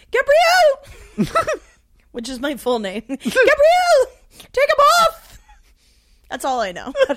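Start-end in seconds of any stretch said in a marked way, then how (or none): 0.1-1.4 high, loud South African call; South African accent. Shown as a demonstration. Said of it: "my South African accent is". 3.1-5.4 high, loud South African holler; South African accent. Shown as a demonstration. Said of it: "my South African accent is".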